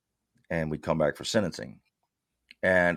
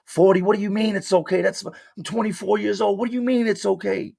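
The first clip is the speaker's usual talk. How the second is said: high-pitched incredulous outburst at the lawyer; snapping; 4 dB louder than usual. Retold or imitated. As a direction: snap back, voice shooting up in disbelief. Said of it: "now I start snapping".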